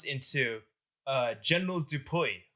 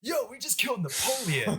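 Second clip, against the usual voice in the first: high, hyped-up mock amazement; thrilled disbelief; 8 semitones higher than usual. Rioters mistaking the general for Napoleon, thrilled disbelief. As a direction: share astonishing news with your mates, pitched up.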